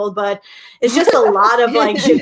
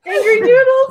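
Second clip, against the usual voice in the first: high, loud laughing cry; laughing about the snake nickname; laughing squeal; 14 semitones higher, 5 dB louder than usual. Real, not acted. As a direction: shriek it while cracking up. Laughing repeat of the joke.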